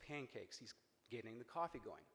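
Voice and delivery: ordinary delivery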